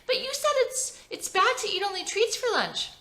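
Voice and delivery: put-on very high small-girl voice; little girl. Little Belle reminding mom about treats. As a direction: pipe up like a four-year-old. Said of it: "Belle, in a puffy pink dress".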